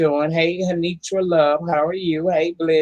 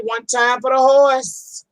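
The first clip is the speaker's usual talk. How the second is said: hype call; 8 semitones higher, 4 dB louder, slower than usual. Real, not acted. Hyping up her horse ride.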